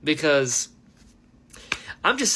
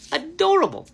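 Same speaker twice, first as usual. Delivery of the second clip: giddy emphasis on Adorable; gushing; 10 semitones higher, 4 dB louder than usual. Real, not acted. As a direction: repeat it higher and louder, delighted. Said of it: "is adorable".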